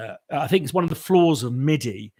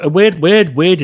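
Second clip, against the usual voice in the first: excited; 7 semitones higher, 13 dB louder than usual. Real, not acted. Excited reaction to weird question.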